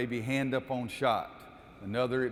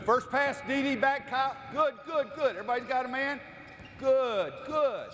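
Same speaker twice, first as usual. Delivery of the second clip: excited courtside calls; 11 semitones higher than usual. Real, not acted. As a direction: cheer the players on as the play unfolds. Coach calling out during live drill.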